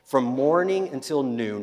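usual speaking voice

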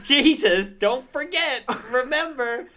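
high, loud hammed-up reminder; mock announcement. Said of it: "to be like".

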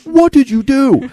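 high, loud, slow accusing dad; suspicious dad. Retold or imitated. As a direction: drag it out like a father smelling trouble. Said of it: "He goes".

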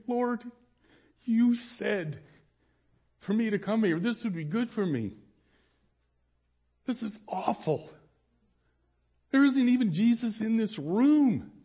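crying prayer. Retold or imitated. Pleading with the Lord through tears, heartbroken. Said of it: "And I start to cry".

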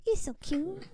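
high, soft cooing as if to a puppy; dog voice. Pretend. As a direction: coo over someone adorable, small and high. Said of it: "Using your dog voice".

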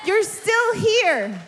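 preacher shouting encouragement; shouted exhortation. Real, not acted. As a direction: drive it home to the crowd. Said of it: "Is this encouraging someone?".

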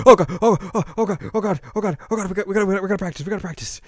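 high, stammering frantic repetition; mock panic. Azusa fretting that nobody practices, mock panic.

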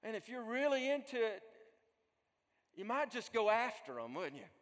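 ordinary talk, nothing marked

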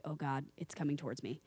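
low, hushed unease; soft, sinking retold alarm; quiet dread. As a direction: mutter it nervously to yourself. Her dread as the cat approaches.

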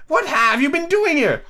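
high, slow interrogation; imperious Queen. A narrator's reading. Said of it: "she went on".

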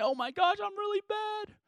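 high, soft mock whimper; mock crying. Preacher mimicking himself crying at home, mock tearful. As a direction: pretend to cry over being bad, voice climbing high and small. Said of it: "put my head down and cry".

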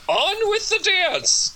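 high, slow, grand declamation; declaiming grandly. A narrator's reading. Charles the pig judge declaims high and slow to his jail party. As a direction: proclaim it like a pompous orator. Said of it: "or declaimed Charles".

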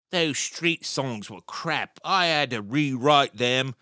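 put-on slow, drawn-out Cockney accent; mock Cockney guitarist, bragging slowly. Quoting a rival guitarist's boast, in a slow Cockney accent. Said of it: "Do you like that Cockney accent?".

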